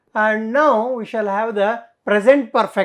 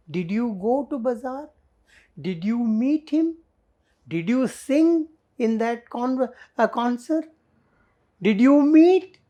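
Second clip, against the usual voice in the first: a string of questions, each lifting, up to a high "Did you meet"; interrogative intonation; 3 semitones higher than usual. Shown as a demonstration. Teacher drilling the class on questions, rising interrogative intonation through "Did you meet". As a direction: make each "Did you" question climb in pitch to its end. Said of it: "Without that intonation, that is not complete".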